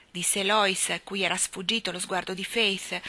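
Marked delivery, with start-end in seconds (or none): none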